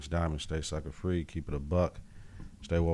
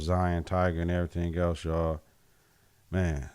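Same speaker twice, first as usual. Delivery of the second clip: normal talking voice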